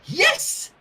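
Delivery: high triumphant yes; cheer. Acted. Rainbow celebrating the baby's word, high cheer. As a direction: cheer the win. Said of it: "Rainbow cheered".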